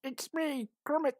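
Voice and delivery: a high, half-hearted frog puppet voice; Kermit voice. Retold or imitated. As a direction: try the frog voice, badly. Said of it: "that was bad".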